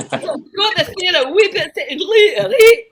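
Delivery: hammed-up incomprehensible Scottish boyfriend; Scottish accent. Scottish boyfriend talking about the theater, loud Scottish accent. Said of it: "dated a Scottish guy".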